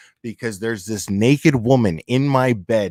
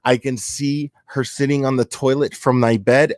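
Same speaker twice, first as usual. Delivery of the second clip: ordinary delivery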